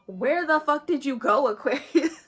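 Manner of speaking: mock-exasperated shout across a distance; calling out. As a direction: yell after a runaway Aquarius. Universe chasing the listener down.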